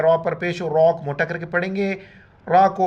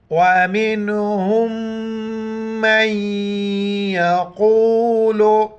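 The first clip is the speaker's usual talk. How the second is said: slow recitation; slower than usual. Shown as a demonstration. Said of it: "मीम को नून के साथ मिलाएंगे".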